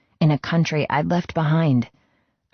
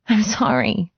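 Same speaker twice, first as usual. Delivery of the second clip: higher, breaking voice near tears; choked up; 7 semitones higher than usual. Acted. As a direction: force it out through a tight throat. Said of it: "I choked".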